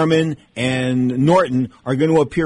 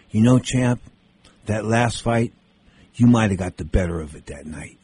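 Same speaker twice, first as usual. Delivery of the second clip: much lower, soft-spoken retold Ali; imitating Ali; 6 semitones lower, 3 dB quieter than usual. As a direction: drop the voice low and speak gently, like the champ making peace. Ali's calm words to Norton.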